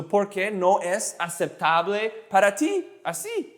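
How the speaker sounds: slow, word by word for learners; slow demonstration. Shown as a demonstration. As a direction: pace it out, pausing between phrases. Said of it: "I'll say it slow".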